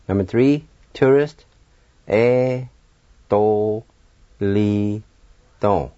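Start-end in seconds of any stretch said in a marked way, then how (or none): 2.1-5.9 "E, to, li, to" as four separate held syllables; E said "eh", then to, li, to, one by one. Shown as a demonstration. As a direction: pause after each of E, to, li, to. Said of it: "now I'll pronounce these words".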